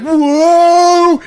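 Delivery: high, loud cry of shock; horrified yelp. Retold or imitated. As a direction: yelp as if recoiling from a corpse. David recoils from the corpse.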